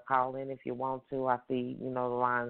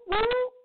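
high loud whoop cry; 6 dB louder than usual. Shown as a demonstration. Host calling the radio audience to praise, loud high whoop. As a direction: let out a big high celebratory whoop. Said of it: "give me a whoop".

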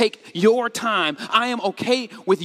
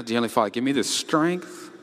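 low, softer, long-suffering plea; mock weary prayer; 10 semitones lower, 4 dB quieter than usual. Bracing to answer a complaining friend, wearily praying. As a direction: pray for patience, dragging out the last words. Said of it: "When you pray before you pick up".

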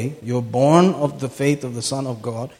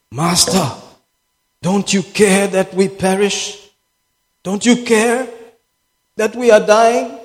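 "higher, rising, urgent appeal"; alarmed plea; 5 semitones higher, 4 dB louder than usual. Acted. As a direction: plead louder and higher, as if waking someone in danger. Voices disciples waking Jesus in storm.

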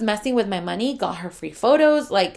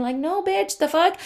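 incredulous outburst; 8 semitones higher than usual. Real, not acted. Outraged rebuttal.

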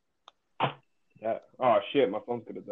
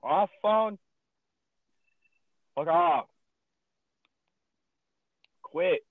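high, loud, exasperated outbursts; real frustration, voice jumping up; getting mad; 6 semitones higher, 7 dB louder, much slower than usual. Real, not acted. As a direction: snap short commands at a broken gadget. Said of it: "getting mad again".

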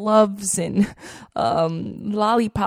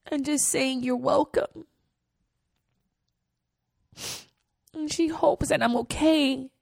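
higher and softer, voice welling up; close to tears; 4 semitones higher, 4 dB quieter than usual. Real, not acted. Telling listeners about the kind note, real tearful softness. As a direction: hold back real tears as you tell it. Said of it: "I didn't think I was gonna cry".

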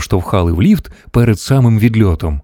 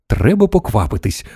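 ordinary delivery, nothing marked

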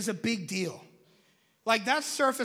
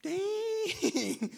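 high astonished dang; 8 semitones higher than usual. Real, not acted. Reacts to scripture.

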